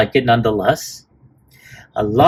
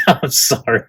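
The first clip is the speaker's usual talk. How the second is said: ordinary talk, nothing marked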